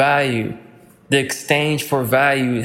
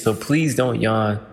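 ordinary talk

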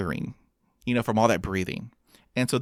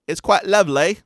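higher, louder mock UK accent; a hammed-up UK accent, louder and higher; put-on UK accent; 8 semitones higher, 8 dB louder than usual. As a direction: show off the UK accent you slip into. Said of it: "there are times when I have an accent".

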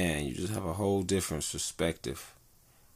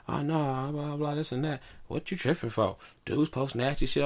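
higher-pitched imitation of her objection; lightly mimicked protest; mock defensive reply; 8 semitones higher than usual. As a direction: voice her brushing off the advice, pitched up. Voicing the woman's defensive reply.

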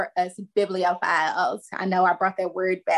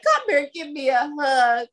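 tender mom; 7 semitones higher than usual. Retold or imitated. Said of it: "I was like".

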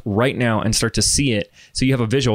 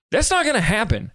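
ordinary talk, nothing marked

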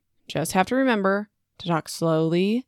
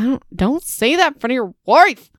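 higher, louder playful scolding; 5 semitones higher, 4 dB louder than usual. Retold or imitated. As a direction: tell him off like a shocked wife. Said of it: "Josh said that".

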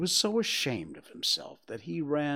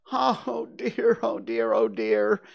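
lightly voiced fretful little pig, louder; worried Piglet; 9 semitones higher, 6 dB louder, slower than usual. A narrator's reading. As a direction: fret aloud in a small character voice. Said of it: "said Piglet to himself".

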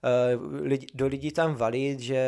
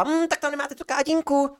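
very high, theatrical mock declaration; hammed-up huffy parting line; theatrical lab assistant; 13 semitones higher than usual. Lab assistant leaving in a huff, very high theatrical voice. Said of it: "strašně teatrálním způsobem prohlásila".

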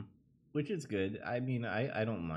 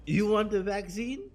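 much higher, louder mocking question; taunting villain; 13 semitones higher, 7 dB louder than usual. Gamer acting out the villain taunting Jill, high and loud. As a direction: tease her with the vaccine. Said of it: "he's like".

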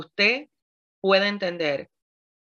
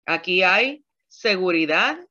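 normal talking voice

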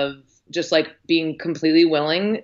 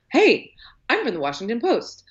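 high, rapid-fire curious questions; eager reporter impression; 10 semitones higher than usual. An earnest reporter grilling strangers with eager questions. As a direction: play the earnest young reporter, higher. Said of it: "He's just like".

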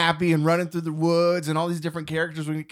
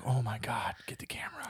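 whisper; 3 semitones lower, 11 dB quieter than usual. Retold or imitated. Husband and wife spot bear.

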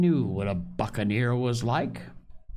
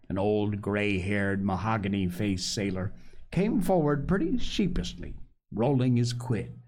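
usual speaking voice

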